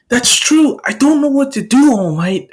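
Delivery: high-pitched, helpless outburst; distressed confession. Acted. Boy confessing doubt to his hero, distressed. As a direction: admit it in a high, rushed plea.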